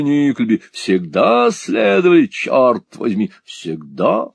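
high, slow, playful dandy; sassy drag queen. Acted. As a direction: simper it out, drawing vowels. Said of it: "игривому воображению".

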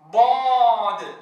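ضاد drawn out very slow and loud; slow held ضاد. Shown as a demonstration. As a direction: stretch ضاد fully. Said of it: "أقول صاد".

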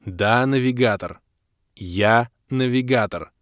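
slow, emphatic, word by word; patient confirmation. A narrator's reading. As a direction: spell it out for someone in shock. Patient confirmation to stunned girl.

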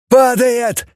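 high, sharp shouted alarm. A narrator's reading. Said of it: "закричал".

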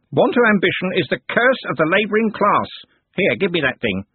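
self-important servant sneering; pompous candle knave. Acted. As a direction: lecture the lowly apprentice. Smeems voiced, pompous.

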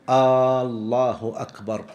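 "الله" opened with a long drawn-out aa, turning it into a question; lengthened first hamza. Shown as a demonstration. Teacher showing students a forbidden takbir, first hamza of "الله" drawn out long. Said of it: "فلا يمد الهمزة في لفظ الجلالة".

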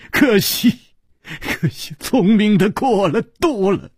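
anguished mocking laughter; bitter laughing. A narrator's reading. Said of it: "他大笑着说".

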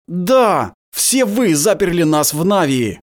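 furious hissed outburst; hissing in fury. A narrator's reading. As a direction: spit the words out in rage. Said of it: "прошипел оборотень".

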